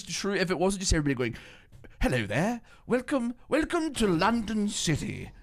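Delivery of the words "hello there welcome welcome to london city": high-pitched mock London accent. Imagining everybody in a British game, hammed-up London accent. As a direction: stretch out an exaggerated London welcome, pitched up. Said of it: "people in London could sound like that".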